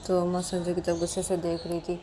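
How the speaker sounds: ordinary delivery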